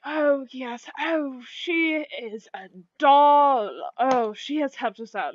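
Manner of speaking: giddy and excited. Acted. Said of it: "giddy and excited".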